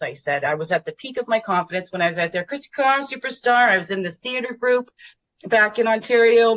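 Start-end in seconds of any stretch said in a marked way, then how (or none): 2.4-3.7 higher, hammed-up showbiz announcement; showbiz announcer flourish. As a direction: belt out your stage name like a star. Stage name repeated, bigger.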